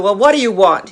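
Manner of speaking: irritated demand. Retold or imitated. Klansman snaps at the caller.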